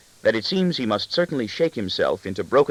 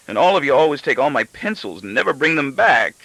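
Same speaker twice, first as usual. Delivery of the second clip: higher, louder petulant complaint; tantrum; 6 semitones higher, 5 dB louder than usual. A narrator's reading. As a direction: whine it like a sulking child. Husband's tantrum at wife.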